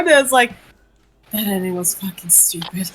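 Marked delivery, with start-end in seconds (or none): 1.3-2.9 tired whisper. Retold or imitated. Retelling the friend's tired reply.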